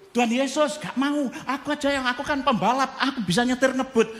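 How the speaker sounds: high, whiny pleading voice; high mock protest to Jesus. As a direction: beg like a stubborn child. Preacher voices himself pleading with Jesus.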